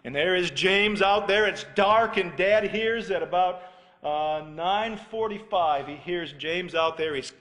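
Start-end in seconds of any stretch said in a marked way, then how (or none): none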